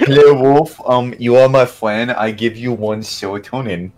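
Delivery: cutesy kitty voice. Pretend. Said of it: "Just kitty faced [name] going like".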